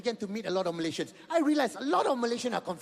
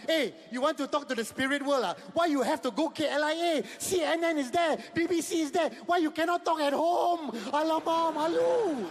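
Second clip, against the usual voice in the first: lightly Malaysian-accented mock scolding, higher; 6 semitones higher than usual. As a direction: chide like a Malaysian uncle, a touch higher. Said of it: "every Malaysian said to himself".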